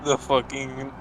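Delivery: ordinary talk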